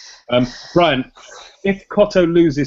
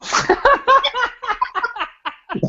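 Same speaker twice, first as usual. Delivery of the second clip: sudden high burst of laughter. Real, not acted. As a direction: crack up at the surprise answer. Host bursts out laughing at pick.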